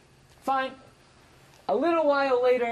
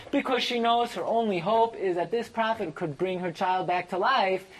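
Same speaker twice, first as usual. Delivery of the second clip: ordinary talk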